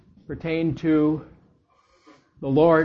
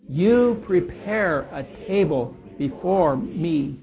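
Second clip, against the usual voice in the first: slow, deliberate scripture reading leaning on key words; slow reverent recitation, every key word weighted; emphatic psalm reading; slower than usual. Shown as a demonstration. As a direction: read it slowly, stressing the words of emphasis. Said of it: "So I want that emphasis to be as we read it".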